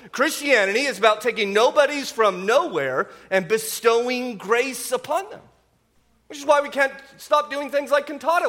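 high, loud, impassioned sermon voice; preaching at full force. Acted. Pastor to congregation on grace, impassioned preaching. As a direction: drive home the gospel with fervour.

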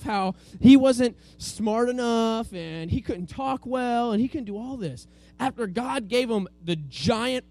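ordinary talk, nothing marked

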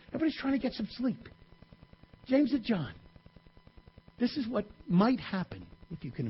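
hushed storytelling. A narrator's reading. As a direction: draw them in with a hush. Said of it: "hushed storytelling aside".

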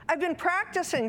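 put-on high, quick child voice; pleading child. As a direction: plead your case to Mum like a child.

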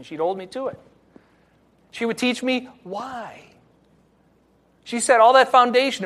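usual speaking voice

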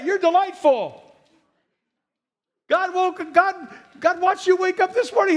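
rally the room with rising fervor; fired-up preaching. Acted. Pastor exhorting the congregation about God's delight, fervently. Preaching builds to full force.

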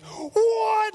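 very high, loud shriek of disbelief; acted freak-out scream; girl squeal. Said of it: "like, girl squeal".

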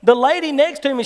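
ordinary talk, nothing marked

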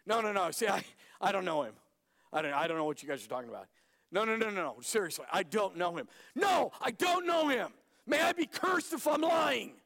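rushed, then loud high shouted oath; frantic denial. Acted. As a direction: spiral into panicked yelling. Peter's frantic cursed denial.

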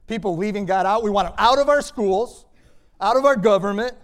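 loud, high rising cries; forceful preaching. Acted. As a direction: preach it at full force, each phrase climbing. Preacher voices the world's rejection.

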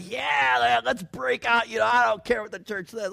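high, loud excited reply; gung-ho. Retold or imitated. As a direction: throw caution away with loud, high-pitched zeal. Retelling own gung-ho reply.

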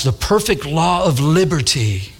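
"The perfect law of liberty" slowly, relishing the sound; savored phrase. Shown as a demonstration. Preacher savoring how "The perfect law of liberty" sounds, slow relish. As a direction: linger over each word of "The perfect law of liberty". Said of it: "don't you like how that, that, that sounds?".

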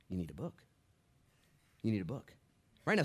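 ordinary talk, nothing marked

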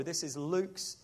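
usual speaking voice

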